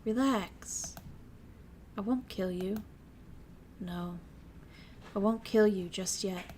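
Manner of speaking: calm and quietly deadly; quiet menace. Acted. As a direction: sister softly threatening the caged girl. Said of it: "Mion spoke from over my shoulder, quietly".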